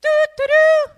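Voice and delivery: loud, high sung trumpet notes; trumpet imitation. Mocking what the Lord didn't say, loud trumpet imitation. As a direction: toot it like a horn. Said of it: "He didn't say".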